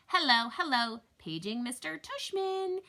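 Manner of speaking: put-on high, loud old-lady announcer; hammed-up high old lady on a loudspeaker; fake old lady voice. A narrator's reading. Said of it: "using a fake high old lady voice".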